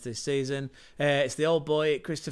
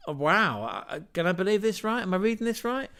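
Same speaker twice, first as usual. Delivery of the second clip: high, quick disbelief; can't believe the lineup; surprised; 6 semitones higher, 4 dB louder, faster than usual. Real, not acted. Podcaster reading a surprising lineup, higher and amazed.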